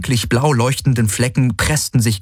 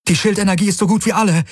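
very high, rapid cry; rushed, high-pitched woman's shout; alarmed call; 12 semitones higher, faster than usual. A narrator's reading. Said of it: "rief Kirai".

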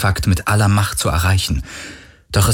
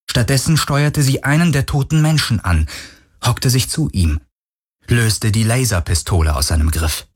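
ordinary delivery, nothing marked

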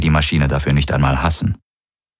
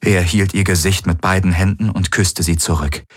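plain reading voice

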